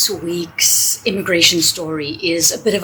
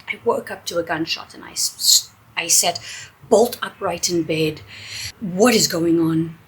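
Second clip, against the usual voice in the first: ordinary talk, nothing marked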